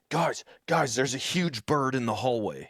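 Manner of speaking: high, alarmed mock panic; panicked coworker. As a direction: play the freaked-out VP bursting in. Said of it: "He's like".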